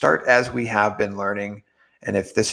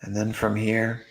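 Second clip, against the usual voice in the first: guided meditation; 5 dB quieter than usual. Real, not acted. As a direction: guide them gently into relaxing, with long pauses.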